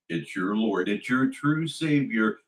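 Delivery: ordinary talk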